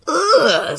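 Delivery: high-pitched ugh of revulsion; disgusted groan. A narrator's reading. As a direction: recoil with a drawn-out ugh. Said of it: "how disgusting".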